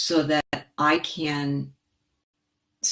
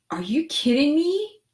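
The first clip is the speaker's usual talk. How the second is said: thrilled disbelief; 10 semitones higher than usual. Real, not acted. Reacts to viewer's offer.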